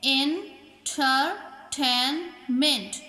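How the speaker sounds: entertainment in four slow beats, en, ter, tain, ment; teacher sounding out en—ter—tain—ment; split into syllables. Shown as a demonstration. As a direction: say entertainment with a gap after every syllable. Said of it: "चार vowel sound देते हों".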